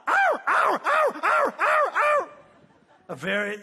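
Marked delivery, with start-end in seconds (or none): 0.1-2.3 high, loud yowling dog noises; dog yelping. Retold or imitated. Dog held back from its food, yelping high and loud. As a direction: yelp like a dog straining for food. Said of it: "And he just starts".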